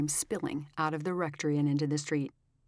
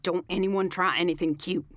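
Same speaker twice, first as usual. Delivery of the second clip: loud threatening command; commanding gunman; 2 semitones higher, 6 dB louder than usual. A narrator's reading. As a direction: warn the whole crowd, menacing. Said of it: "the man commanded".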